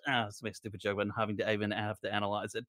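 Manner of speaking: usual speaking voice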